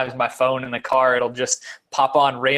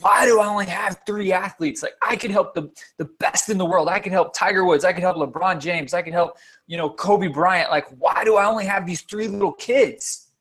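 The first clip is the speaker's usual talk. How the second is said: mock outcry, pitched well up without full volume; retold frustrated yell; 6 semitones higher than usual. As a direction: act out yelling at God in frustration. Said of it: "I just yelled out and I was like".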